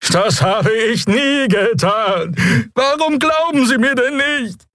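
high, drawn-out alien wail; desperate Draxyl. Acted. Prisoner asking why nobody believes him, desperate. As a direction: deny it slowly, wailing high.

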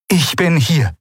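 high voiced calm announcement; announcing Aylan. A narrator's reading. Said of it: "verkündete die Shayang".

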